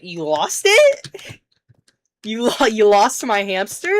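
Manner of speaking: shocked outburst. Retold or imitated. Kid yelling at friends who lost his hamster, shocked loud disbelief. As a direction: stretch it out in outraged disbelief.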